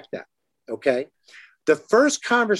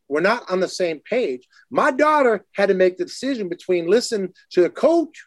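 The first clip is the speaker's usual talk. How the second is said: ordinary delivery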